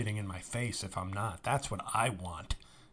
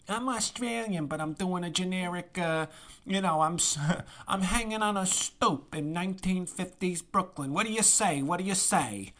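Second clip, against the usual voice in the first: much higher, mocking 1950s Brooklyn accent; mocking old-timey Brooklyn; 8 semitones higher than usual. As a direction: lampoon an actress faking a Brooklyn stoop voice. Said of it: "she does this very annoying kind of old-timey Brooklyn".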